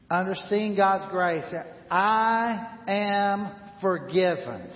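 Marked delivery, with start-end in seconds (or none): 1.9-4.7 slow, deliberate I am forgiven; slow declaration. Shown as a demonstration. Pastor leading the church in a slow declaration. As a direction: lead the congregation in I am forgiven. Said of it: "Say that with me".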